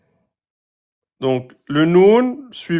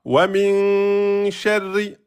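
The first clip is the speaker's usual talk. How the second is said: slow chanted "Min sharri" with a long held min; Quran recitation; 3 semitones higher, much slower than usual. Shown as a demonstration. Teacher chanting verse slowly for students. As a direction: intone it like tajwid recitation. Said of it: "Et là".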